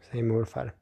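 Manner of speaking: ordinary delivery, nothing marked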